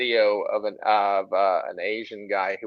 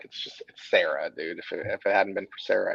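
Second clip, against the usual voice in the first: usual speaking voice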